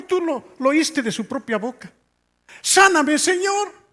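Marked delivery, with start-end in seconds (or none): 2.6-3.8 very high, drawn-out cry to God; high-pitched imploring retold voice; imitating tearful plea. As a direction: act out a desperate prayer for healing. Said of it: "estaba llorando cuando decía eso".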